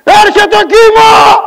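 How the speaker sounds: high, very loud, stretched-out yell; crazed shouting. Retold or imitated. Warning the beach with a megaphone, crazed shout. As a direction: shout it like a madman. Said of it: "Άρχισα να φωνάζω, σαν, σαν τρελός".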